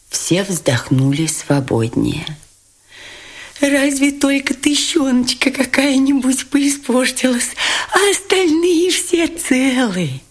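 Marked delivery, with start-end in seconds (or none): 3.6-10.2 tenderly moved. A narrator's reading. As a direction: coo it with relief. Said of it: "с умилением выговорил Лебедев".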